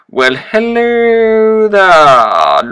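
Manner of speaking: high, loud, hugely drawn-out hello; exaggerated greeting. Player greets new people with a long, high, loud hello. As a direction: stretch the hello and let it slide down.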